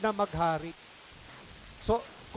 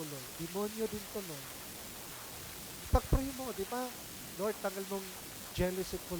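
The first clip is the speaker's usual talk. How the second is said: softer, pleading prayer; modeled prayer; 6 dB quieter than usual. Shown as a demonstration. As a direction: murmur a humble plea to the Lord. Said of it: "Lapit ka lang kay Lord". (mapagpakumbabang dasal na mahina)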